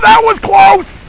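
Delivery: high, loud slow cry of relief; relieved laughing shout. Real, not acted. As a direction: shout with a laugh after a narrow escape. Narrow escape in game.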